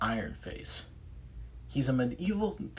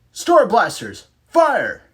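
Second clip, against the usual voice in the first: loud, high command; battle command shout; 12 semitones higher, 14 dB louder, slower than usual. Acted. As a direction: shout the firing order. Rangers firing weapons.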